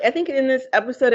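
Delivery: normal talking voice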